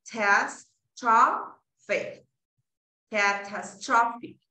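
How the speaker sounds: "catastrophic" slowly broken into separate syllables; syllable-by-syllable reading. Shown as a demonstration. Teacher reading the transcription exactly as written, slow syllables. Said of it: "Eso es lo que dice".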